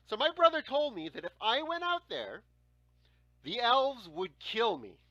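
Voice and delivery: ordinary delivery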